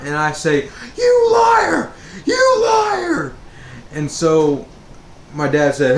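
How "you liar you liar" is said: very high, loud crying scream of accusation; sobbing shout. Retold or imitated. Frightened son screams at his pranking mom, crying shout. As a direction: wail it through tears at her.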